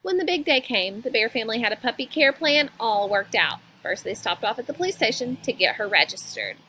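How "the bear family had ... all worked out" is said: usual speaking voice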